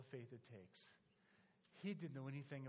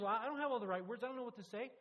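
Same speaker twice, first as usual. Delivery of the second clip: higher and much louder flustered objection; loudly refuse, all flustered; retold loud protest; 7 semitones higher, 9 dB louder, faster than usual. Soldier protests he lacks words.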